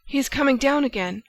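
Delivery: sudden high cry of alarm; alarmed exclamation. A narrator's reading. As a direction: cry it out in fright. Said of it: "Suddenly she exclaimed".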